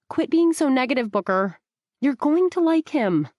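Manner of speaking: a light rebuke with a push of annoyance; narrator's Rebecca telling Booker off; Rebecca, scolding. A narrator's reading.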